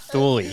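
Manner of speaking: Thule sounded out as thool; sounding out words. Shown as a demonstration. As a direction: spell out Thule phonetically as thool. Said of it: "sound out the words".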